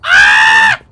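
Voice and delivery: low loud yell; battle cry shout. Real, not acted. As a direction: roar it out.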